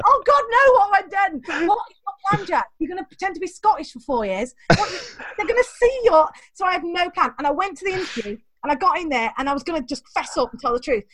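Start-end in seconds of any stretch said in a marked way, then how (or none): 0.1-6.3 higher, louder, panicked; hammed-up horror at what he's done; mock panic. Inner voice panicking after faking a Scottish accent. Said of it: "they're like".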